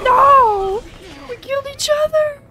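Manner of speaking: high, loud outraged yells; frantic shouting. Real, not acted. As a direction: scream at the unfairness. Both players die at once.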